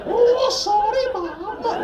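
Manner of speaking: high, slow cartoon Welsh apology; Welsh accent. Norman apologising for setting fires, high Welsh accent. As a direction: draw it out in sing-song mock Welsh. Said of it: "all my Welsh accents are based".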